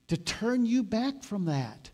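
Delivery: ordinary talk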